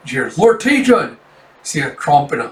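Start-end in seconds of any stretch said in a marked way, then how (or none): 0.4-1.1 high, loud shout to a lord; alarmed call. Retold or imitated. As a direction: call after him in alarm. Villagers cry out to lord.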